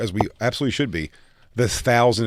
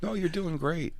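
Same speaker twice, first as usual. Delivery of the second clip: fake-nice crew; 7 semitones higher, 5 dB quieter than usual. Crew fakes niceness to Bobby.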